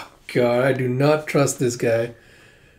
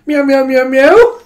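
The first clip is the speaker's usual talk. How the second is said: much higher, louder cute meowing; kitten meows; 11 semitones higher, 10 dB louder, slower than usual. Acted. Puppet goblin playing a kitten, high cute meows. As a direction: mew like a tiny fluffy kitten.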